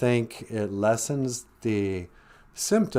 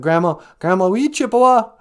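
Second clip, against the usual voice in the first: put-on child asking grandma; 8 semitones higher, 7 dB louder than usual. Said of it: "I said".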